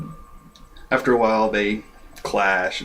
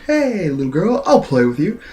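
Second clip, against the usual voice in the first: higher-pitched put-on greeting to a child; mock hearty champion; 7 semitones higher, 5 dB louder than usual. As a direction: play a show-off humoring a little kid. Voices Mister Satan approaching girl.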